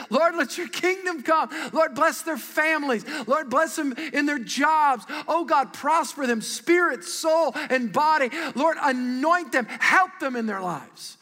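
high, loud, fervent pulpit prayer. Acted. Pastor praying over small group leaders, fervent and loud. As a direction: pour it out over them like a blessing.

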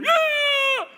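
put-on shrill kid shout; high gloating yell; high taunting yell.

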